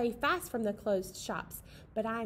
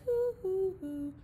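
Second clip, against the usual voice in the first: owl hoots; 4 semitones higher, much slower than usual. Pretend. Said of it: "The owl in the tree says".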